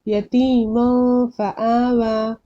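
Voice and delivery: यतीमा फआवा slow, last vowel of यतीमा held nasally; ikhfa recitation. Shown as a demonstration. Teacher reading the next example likewise, noon hidden. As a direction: stretch यतीमा into a nasal hum before फआवा. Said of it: "वैसे ही".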